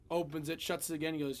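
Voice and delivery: ordinary talk, nothing marked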